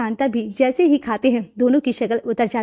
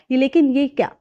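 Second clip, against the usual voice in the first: ordinary delivery, nothing marked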